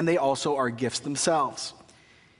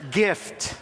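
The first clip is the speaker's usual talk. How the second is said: sarcastic; 3 semitones higher than usual. Real, not acted. Ironic punchline after messy baby.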